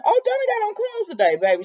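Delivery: high, mocking tease; sassy teasing. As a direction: tease her playfully up high. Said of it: "And I said".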